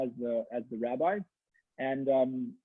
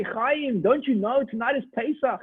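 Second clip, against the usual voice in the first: incredulous reply; 7 semitones higher, 3 dB louder than usual. Acted. People answer Chaim.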